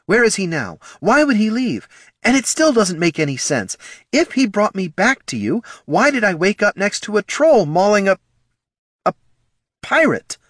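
high, urgent questioning; agitated, high-pitched interrogation; pressing demand. Acted. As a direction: push each question, pitched high and insistent. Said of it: "the elf pressed".

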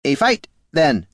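stiff construct voice; golem voice. Acted. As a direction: speak like a clockwork golem. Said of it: "said the golem".

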